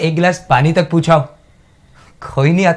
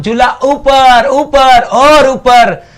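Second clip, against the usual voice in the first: joyful stretched call; 7 semitones higher, 6 dB louder, much slower than usual. Acted. Recalls father pushing swing higher.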